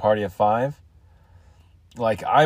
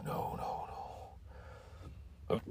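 whispered no; breathy whisper; 22 dB quieter than usual. Real, not acted. Whispered groan over hiccups.

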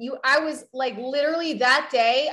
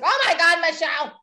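louder, exasperated mom calling out; half-hearted imitation of a yelling mom; mild mock yell; 5 semitones higher, 5 dB louder than usual. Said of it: "she was yelling at me".

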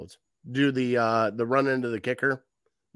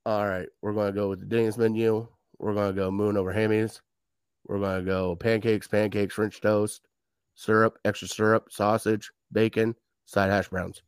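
lower, droning robot coach; robotic coach; 6 semitones lower than usual. Retold or imitated. Coach calling plays like ordering off a menu, robotic monotone. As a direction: recite it like an emotionless machine. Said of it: "this robot just walking on there going".

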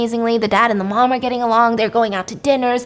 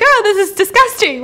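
high, loud squeal of disgust; hammed-up grossed-out cry; mock disgusted scream; 10 semitones higher, 5 dB louder than usual. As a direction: imitate someone shrieking at something gross. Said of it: "And she would scream and jump".

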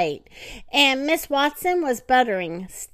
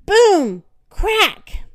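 loud, high sound words; dramatic boom; 8 semitones higher, 7 dB louder than usual. A narrator's reading. Narrator telling the bed falling further, loud boom. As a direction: make the floor crash.

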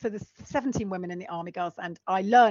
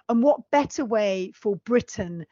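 ordinary delivery

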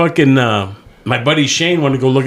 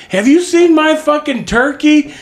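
pitched way up and slow, asking after a lost turkey; high mock neighbor voice; 3 dB louder, slower than usual. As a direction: play the worried neighbor in a high squeaky voice. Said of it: "the neighbor's like".

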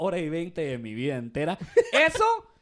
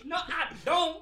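lightly high mock señora voice; mock old lady; 8 semitones higher, much slower than usual. Agent repeating the caller's retort, a lighter mock old lady. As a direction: toss it off again in a pinched caller voice. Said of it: "te decía así como".

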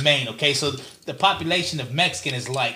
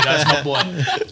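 slow, drawn out through laughter; laughing; 4 dB louder, much slower than usual. Real, not acted. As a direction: chuckle it out fondly. Shout-out repeated laughing.

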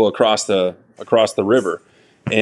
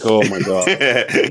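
mock panic; much slower than usual. Same panic retold.

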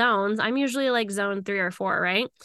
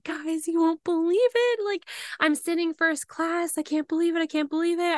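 higher, excited gushing to friends; excited disbelief; 7 semitones higher than usual. Retold or imitated. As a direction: share the news giddy with disbelief. Telling friends about first class.

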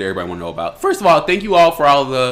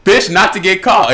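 higher, louder comeback; mock indignant retort; 6 semitones higher, 7 dB louder than usual. Answering an imagined question about the end goal, mock indignant. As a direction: fire back at the question, indignant.

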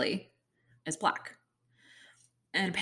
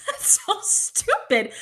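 high, slow laughing put-down; laughing scorn; 10 semitones higher, 5 dB louder, slower than usual. Real, not acted.

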